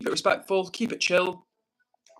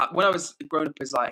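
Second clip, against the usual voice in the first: ordinary delivery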